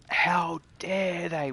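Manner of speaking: slow, drawn-out mock outrage; mock indignant. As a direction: imitate angry gamers, dragging it out. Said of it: "with anger in their voice".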